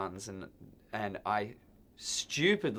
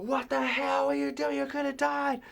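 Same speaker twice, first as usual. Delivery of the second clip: much higher, different voice, alarmed scolding; furious guard. Retold or imitated. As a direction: chew him out like the panicked guard. Security guard scolding him.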